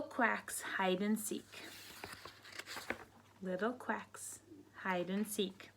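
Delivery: hushed whispered title. Real, not acted. Teacher whispering the next book title to kids, whispering. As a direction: breathe the title out softly.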